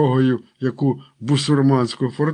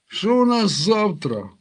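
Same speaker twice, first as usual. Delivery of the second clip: yawning; 6 semitones higher than usual. A narrator's reading. Марко asks about tomorrow after the all-night feast, yawning. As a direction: ask it through a lightly acted yawn. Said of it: "позіхаючи, запитав Марко".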